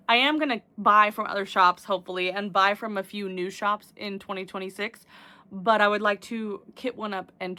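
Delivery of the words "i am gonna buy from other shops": ordinary talk, nothing marked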